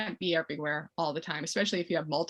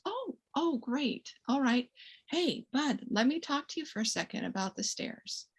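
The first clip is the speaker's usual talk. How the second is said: put-on soft to the child; 6 semitones higher, 5 dB quieter than usual. Shown as a demonstration. Parent takes the child aside.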